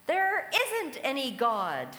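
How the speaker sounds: slow shouted denial; angry shout. Retold or imitated. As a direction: yell it back over the fence at her. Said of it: "and he would shout".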